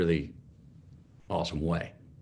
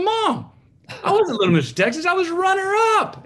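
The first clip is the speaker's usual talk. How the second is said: much higher and louder, shocked disbelief; shocked protest; 6 dB louder than usual. Retold or imitated. Son phoning mom about the trophy, high shocked protest. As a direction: cry it out as the truth dawns. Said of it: "I go".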